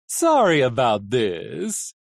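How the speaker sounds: slow, swooping theatrical call; gleeful villain's singsong apology; crying out. A narrator's reading. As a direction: call it out, stretched and lilting. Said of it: "the coachman cried".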